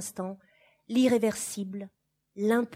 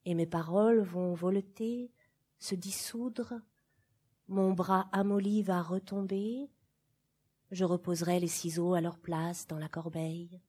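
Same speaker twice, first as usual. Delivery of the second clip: ordinary delivery, nothing marked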